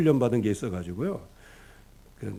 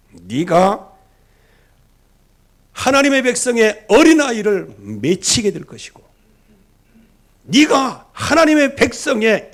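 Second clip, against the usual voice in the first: prophetic pronouncement; 10 semitones higher, 8 dB louder than usual. Retold or imitated. Elisha pronouncing God's judgment on Hazael, loud and solemn. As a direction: thunder each "네가" as a judgment. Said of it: "하나님께서".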